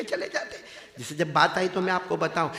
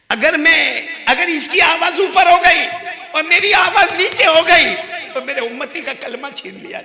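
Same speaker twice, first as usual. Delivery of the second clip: high, forceful plea at full voice; loud, fervent preacher's outburst; impassioned shouting; 11 semitones higher, 15 dB louder than usual. Acted. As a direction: cry out the warning urgently. Acts Prophet's impassioned explanation.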